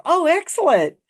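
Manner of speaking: high, loud mock-impressed oh excellent; tossing out a bright burst of praise; impressed exclamation. Storyteller recalls praising Matt's smooth move, loud and high. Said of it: "the only time I said anything that was positive".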